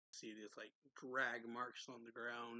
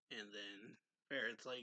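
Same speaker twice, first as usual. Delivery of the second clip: ordinary talk, nothing marked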